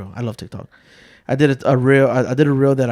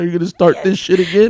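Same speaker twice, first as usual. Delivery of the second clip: mock-angry kid, raised pitch; retold anger; 5 semitones higher, 4 dB louder than usual. Son fed up with dad's teasing, mock anger. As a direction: imitate an indignant son. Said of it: "He got mad one time".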